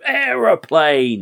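drawn out, high and loud in disbelief; mock outrage at the absurd plot; incredulous exclaim. Shown as a demonstration. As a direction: stretch the word out, baffled. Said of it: "Why would it be an aeroplane?".

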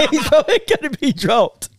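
high-pitched, through laughter; laughing in disbelief at a daft pick. Real, not acted. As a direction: crack up while saying it. Cracking up at teammate's pick.

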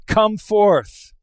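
high, loud command; ringing preacher's call. Acted. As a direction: summon him with full force. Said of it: "he calls out".

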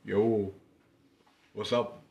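low, soft, offhand hello; imitating dad's greeting. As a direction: toss out a quiet, drawn-out dad greeting. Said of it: "he just goes".

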